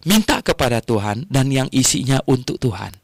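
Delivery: ordinary delivery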